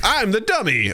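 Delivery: high, hammed-up dummy voice; silly high-pitched goofball; improv dummy character. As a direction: announce it high, as the butt of the joke. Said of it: "being the butt of a joke".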